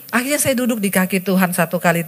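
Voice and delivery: normal talking voice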